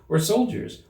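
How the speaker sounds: plain reading voice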